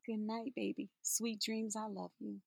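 soft, tender bedtime goodnight; soft goodnight to a loved one. Shown as a demonstration. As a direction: say goodnight softly to a child. Bedtime wish demo.

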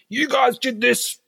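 high, slow mock accusation from the boss; a boss pointing the finger at his crew, in a high slow mock accusing voice. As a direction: drag it out like a boss pinning the blame. Boss accusing staff, imagined.

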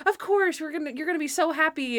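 high, excited mock encouragement; put-on high-pitched enthusiasm; acted gushing. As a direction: imitate someone gushing happily. Acting out Natalie coming around.

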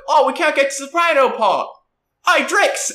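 put-on high, loud kid voice, cheeky; street kid calling out. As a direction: yell his name like a brat about to strike. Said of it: "and they're like".

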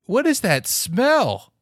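high, slow, loud disgust; disgusted by smell. Acted. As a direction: gag at the stink. Acted guests in smelly room.

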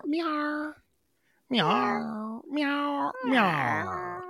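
high cat meow. Pretend. Repeated cat meows.

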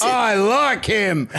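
slow, hearty delight; Wrecker's delighted voice. Acted. Wrecker taking to the kid, delighted. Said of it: "And Wrecker laughs".

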